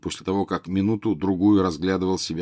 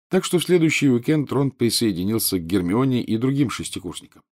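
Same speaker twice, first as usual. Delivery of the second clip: ordinary delivery, nothing marked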